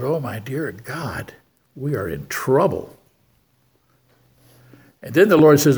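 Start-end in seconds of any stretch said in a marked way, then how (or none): 0.0-3.0 quiet, slow aside of alarm; hushed dismay. Retold or imitated. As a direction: mutter it to yourself in dread. Inner thought, dismay at church.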